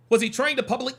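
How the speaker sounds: normal talking voice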